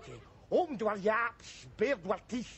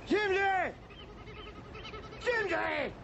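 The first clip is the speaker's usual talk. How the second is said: calling out; 11 semitones higher, slower than usual. Acted. Comic drunk Scot hollering for his mate Jimsy. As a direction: shout his name to be heard far off.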